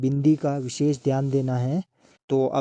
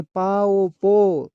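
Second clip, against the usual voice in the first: पाओ पो stretched long and a bit louder; drawn out slowly; 3 semitones higher, 4 dB louder, much slower than usual. Shown as a demonstration. Teacher drawing out पाओ पो slowly for young readers. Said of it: "teacher spelling out syllables".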